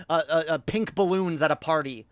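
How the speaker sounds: ordinary talk, nothing marked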